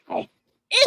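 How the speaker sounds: high, scrunched-up disgust. Retold or imitated. Answering her honey, a high disgusted ew. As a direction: recoil from it. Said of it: "I was like".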